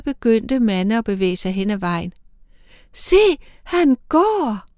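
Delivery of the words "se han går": much higher sudden cry; exclamation. A narrator's reading. Young angel seeing Mande walk off, exclaiming. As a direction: blurt it out in alarm. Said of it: "udbrød den yngste".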